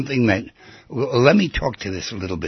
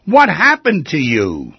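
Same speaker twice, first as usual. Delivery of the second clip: louder, drawn-out concerned question; slow, pressing, raised voice; 4 semitones higher, 7 dB louder, slower than usual. Shown as a demonstration. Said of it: "repeated question, varied delivery".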